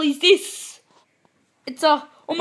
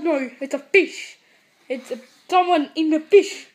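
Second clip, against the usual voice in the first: ordinary talk, nothing marked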